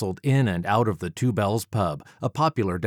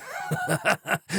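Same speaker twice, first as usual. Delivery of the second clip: high scornful villain laugh; mocking laugh; 10 semitones higher, 3 dB quieter than usual. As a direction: laugh off the sword with contempt. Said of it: "he laughed".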